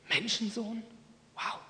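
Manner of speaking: high, hushed gasp of wonder; the crowd catches on and marvels; amazed reaction. Retold or imitated. Preacher voicing the listeners, amazed and hushed.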